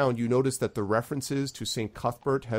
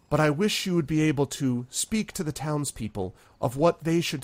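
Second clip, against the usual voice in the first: noble town leader, formal speech; 4 semitones higher than usual. Acted. Town leader addresses the heroes, lofty and ceremonial. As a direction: lift the voice and address the whole town.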